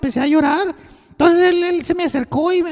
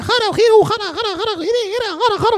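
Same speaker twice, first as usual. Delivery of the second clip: higher, laughing fake Arabic babble; mock Arabic gibberish; 5 semitones higher than usual. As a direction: imitate a man jabbering in Arabic. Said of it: "el güey habla árabe".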